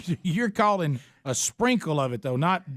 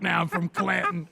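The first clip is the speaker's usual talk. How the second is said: mock Southern accent. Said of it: "I sound like".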